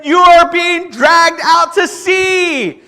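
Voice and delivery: high, loud and slow warning shout; urgent warning. Retold or imitated. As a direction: spell out the danger across the water. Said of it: "And he said".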